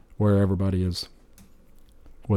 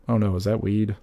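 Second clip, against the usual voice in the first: ordinary talk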